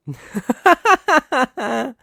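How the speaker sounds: loud, high cackling laugh of contempt; mocking laugh. Villain scoffing at the rival's pretty folder, a loud high laugh. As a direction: laugh at how naive they are.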